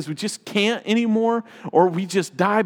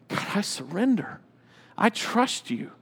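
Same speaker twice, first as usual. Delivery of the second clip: soft, prayerful declaration; softly spoken prayer of trust; hushed surrender; 6 dB quieter than usual. Acted. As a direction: give in quietly to God.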